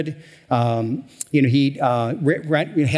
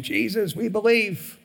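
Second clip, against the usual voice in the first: worshipper; 7 semitones higher, 3 dB quieter, slower than usual. Retold or imitated. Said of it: "you're shouting, you're singing".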